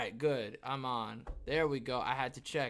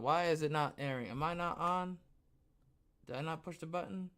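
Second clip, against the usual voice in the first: ordinary delivery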